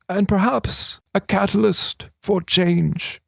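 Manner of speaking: a hint of a sly old regent. A narrator's reading. Regent confiding to thief, lightly voiced old man.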